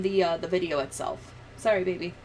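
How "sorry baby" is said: ordinary talk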